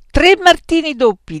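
high, very loud demand; called-out order. A narrator's reading. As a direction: top the order emphatically. Beatrice tops the order loudly.

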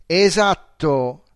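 exclaimed. A narrator's reading. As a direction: stretch it out in triumph. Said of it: "esclamò".

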